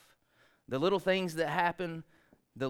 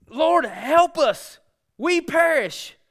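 slow, higher, louder pleading; frightened plea; 12 semitones higher, 10 dB louder, much slower than usual. Retold or imitated. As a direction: drag out a scared cry for rescue. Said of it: "as the disciples go".

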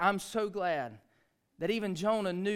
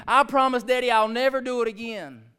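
pleading daughter; 9 semitones higher, 14 dB louder than usual. Retold or imitated.

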